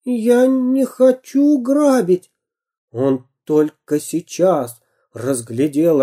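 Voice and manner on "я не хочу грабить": much higher, slow fearful plea; high scared whine; frightened child. A narrator's reading. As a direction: plead in fear.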